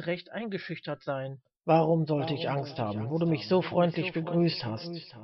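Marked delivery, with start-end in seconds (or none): 1.6-5.1 deep, loud resonant declaration; church-filling voice. A narrator's reading. Old man answering the preacher, booming low voice. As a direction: let a low voice fill the church. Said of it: "mit einer Stimme, die nicht nur die Kirche erfüllte".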